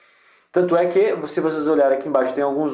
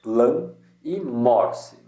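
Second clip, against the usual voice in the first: "lan" and "morse" slow and set apart; isolated stressed syllables; much slower than usual. Shown as a demonstration. Teacher picking out tonic syllables, slow stressed "lan" and "morse". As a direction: draw out "lan", then "mor" in "morse". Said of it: "são só duas sílabas tônicas".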